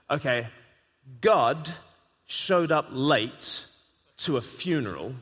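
slow, weighty pauses between the words; dramatic storytelling. Shown as a demonstration. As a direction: build it up like a campfire tale. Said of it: "You say".

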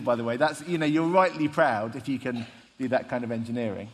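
ordinary talk, nothing marked

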